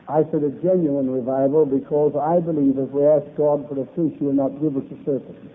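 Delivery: ordinary talk, nothing marked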